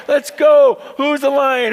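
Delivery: high, loud rallying cry at the lion; rallying shout. Acted. Zebra urging the lion to run: loud cheering shout. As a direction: fire him up, shout it out. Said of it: "you know, like".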